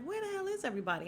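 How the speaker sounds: high, fast exasperated demand. Retold or imitated. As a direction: imitate a fed-up woman calling round. Acted exasperated caller in retelling.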